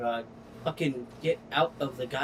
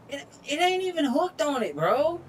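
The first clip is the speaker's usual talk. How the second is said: much higher, whiny disbelief; exasperated whine; 11 semitones higher than usual. Real, not acted. Gamer complaining at the stuck trailer, high whine. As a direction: squeal it in disbelief.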